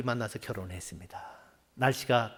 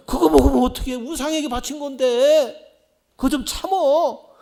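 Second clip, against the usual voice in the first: loud pleading warning; 10 semitones higher, 11 dB louder than usual. Retold or imitated. Warning against idol food.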